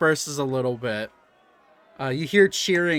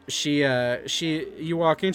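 normal talking voice

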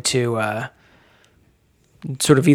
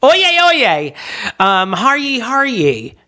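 mock court crier; 4 semitones higher than usual. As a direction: ham up an old-timey bailiff crying oyez. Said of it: "mock court crier".

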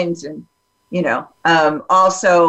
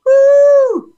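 a high, loud, whooping cheer; excited whoop; 6 dB louder than usual. Retold or imitated. Reacting to a Scottish guy's voice on TikTok with a high loud whoop. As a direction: whoop like the hot Scottish voice set you off. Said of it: "Just like".

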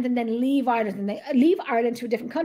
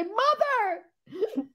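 high, laughing wail of "Mother"; mock outcry to mother; 9 semitones higher, 4 dB quieter than usual. Daughter jokingly confronting mother over ancestry, mock outcry. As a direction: call out in playful protest, pitched way up. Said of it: "I'm gonna say".

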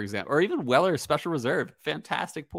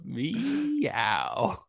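higher, stretched playful meow; joking meow; 5 semitones higher than usual. Retold or imitated. Repeating the joke phrase for the co-host, playful stretched meow. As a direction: draw out a teasing "meow". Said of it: "in a very joking way".

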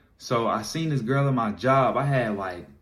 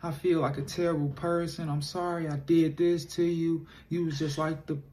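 higher, soft guilty confession in her voice; imitating a sorry girlfriend, higher and hushed; apologetic girlfriend; 6 semitones higher, 3 dB quieter than usual. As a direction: play the remorseful girlfriend, slowing at the end. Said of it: "she like".

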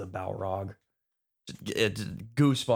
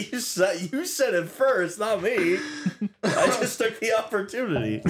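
high-pitched, laughing self-defence; giggly, pitched-up denial; laughing protest; 12 semitones higher, 4 dB louder than usual. Real, not acted. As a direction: protest through real laughter. Defends himself while cracking up.

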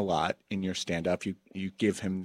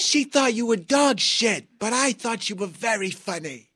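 put-on much higher, louder old-lady voice; 13 semitones higher, 3 dB louder than usual. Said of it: "And they'll say".

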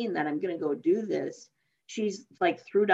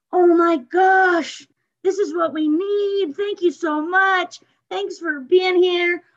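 high, slow, choked-up thanks, much higher and louder; tearful gratitude; 9 semitones higher, 7 dB louder, slower than usual. Retold or imitated. Protesters thanking the hugger in a high, tearful, slow voice. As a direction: thank her as if near tears.

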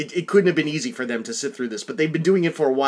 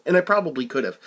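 usual speaking voice

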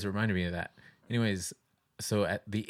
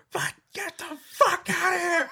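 high, loud panicked sleep-scream; imitated scream; 7 dB louder than usual. Dreamer screaming at an attacker, imitated high scream. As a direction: re-enact screaming out of a nightmare, high-pitched. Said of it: "you were fucking screaming really loud".

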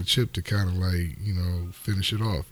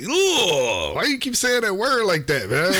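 disgusted; 12 semitones higher, 4 dB louder, slower than usual. Retold or imitated. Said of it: "I was like".

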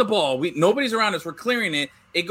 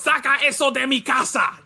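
keeper's Spanish yell; 7 semitones higher than usual. Retold or imitated. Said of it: "He goes".

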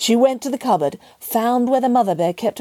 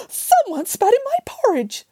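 high-pitched mother bear outcry; outraged exclamation. A narrator's reading. Mother bear discovering the spit, shocked exclamation.

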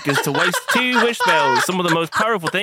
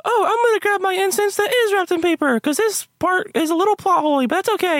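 character voice; 9 semitones higher than usual. Acted. High character voice.

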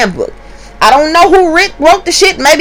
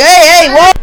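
retold alarmed shout; 7 semitones higher, 6 dB louder than usual. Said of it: "everybody's like".

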